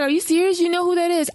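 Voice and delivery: exasperated husband. Retold or imitated. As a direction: play the husband in mock disbelief. Husband answers quarrel retold.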